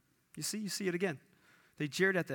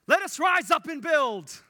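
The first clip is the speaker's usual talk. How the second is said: rousing shout; 8 semitones higher, 7 dB louder than usual. Retold or imitated. People's rousing reply quoted.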